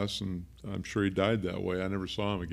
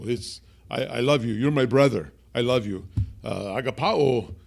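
put-on high, loud, heartfelt Greek-accented lines; 5 semitones higher, 8 dB louder than usual. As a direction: imitate George's Greek accent, building. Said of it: "I'm imitating his accent".

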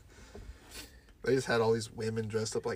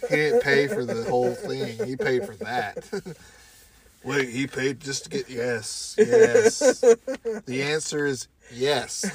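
ordinary talk

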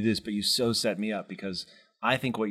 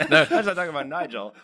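talking through real laughter, louder; laughing; 7 semitones higher, 6 dB louder than usual. Real, not acted. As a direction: giggle the words out. Laughing at joke about Nigel.

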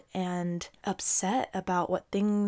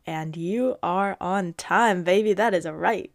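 emphatic hype; 6 dB louder than usual. Real, not acted. Emphatic encouragement to listener.

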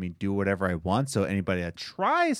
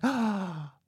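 surprised; 13 semitones higher, much slower than usual. Retold or imitated. Imitating delighted reaction to gift.

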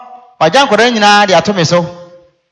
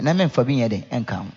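ordinary talk

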